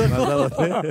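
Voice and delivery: high, loud words breaking into laughter; laughing. Real, not acted. Host enjoys the guests' shared refrain, laughing. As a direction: say it through a big high-pitched laugh.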